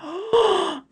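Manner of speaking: high, soft acted gasp; amazed gasp. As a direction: gasp in sudden wonder. Retold child's delight at applause.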